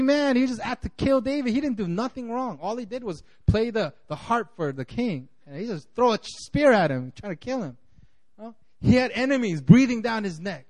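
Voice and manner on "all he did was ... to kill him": ordinary delivery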